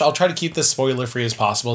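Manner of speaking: ordinary talk, nothing marked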